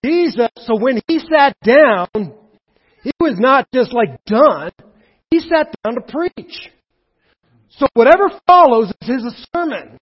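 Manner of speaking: loud, high, driving pulpit voice; preaching at full force. Acted. Preacher to his congregation, full-force preaching.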